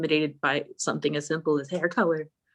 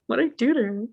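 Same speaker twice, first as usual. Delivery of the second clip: mock bewildered protest; 7 semitones higher, 3 dB louder than usual. Retold bewildered protest to other girls.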